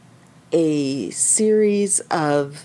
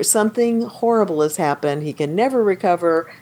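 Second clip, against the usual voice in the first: ordinary talk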